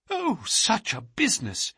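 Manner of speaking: high, anguished exclamation in dismay; a high, stricken cry of alarm; cried out. A narrator's reading. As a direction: cry it out, hands to his temples. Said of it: "he cried".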